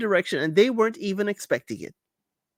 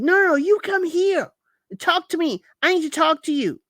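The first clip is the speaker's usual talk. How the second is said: mock insistent demand; 9 semitones higher than usual. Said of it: "She's like".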